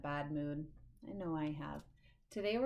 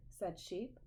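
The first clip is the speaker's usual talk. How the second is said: usual speaking voice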